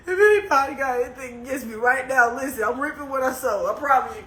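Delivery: ordinary talk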